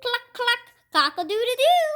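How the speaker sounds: high, loud chicken calls; chicken clucking. A narrator's reading. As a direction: crow and cluck like a farmyard hen. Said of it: "say the chickens".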